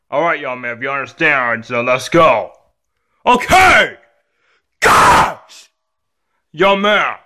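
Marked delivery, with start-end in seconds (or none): 3.2-5.7 exasperated yell into a scream. Acted. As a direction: give in with a high tantrum shout. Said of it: "stop screaming".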